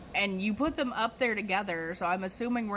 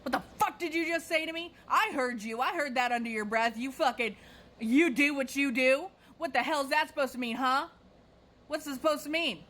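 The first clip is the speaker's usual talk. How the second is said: high-pitched mock yell of an irate customer; heated, indignant retold outburst; retold angry shouting; 5 semitones higher than usual. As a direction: imitate a customer demanding an explanation.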